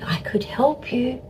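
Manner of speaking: high, half-whispered imitation of a partner; gentle offer. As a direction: offer softly, almost whispering. Voices partner gently offering help.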